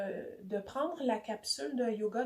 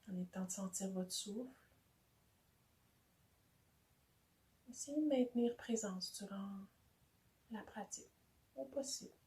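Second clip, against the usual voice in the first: soft, unhurried body-scan voice with long pauses; hushed, calm relaxation guide; guided meditation; 5 dB quieter than usual. Real, not acted. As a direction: guide them gently, phrase by phrase, into rest.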